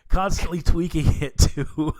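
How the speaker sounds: laughing through the words; giggling at own slip. Real, not acted. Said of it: "I almost said twerking it".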